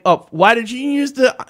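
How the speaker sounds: high-pitched mock protest; mimicked complaint. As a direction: imitate a whiny complainer, pitched well up. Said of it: "And now you're saying".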